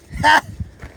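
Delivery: one dry scoffing laugh; short bitter laugh. Real, not acted. Bitter laugh about family.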